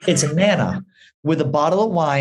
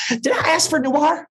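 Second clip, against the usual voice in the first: higher, laughing send-up of a fussy customer; mock diva complaint; 8 semitones higher than usual. Mocking Joey scolding the waiter, laughing high diva voice. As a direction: ham up his indignation while laughing.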